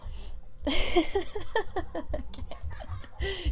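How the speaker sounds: high helpless laughter; real giggling fit. Real, not acted. Reader losing it while reading aloud, giggling. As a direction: crack up mid-sentence. Reader cracks up mid-line.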